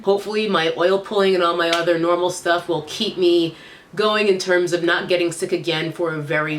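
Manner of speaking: ordinary delivery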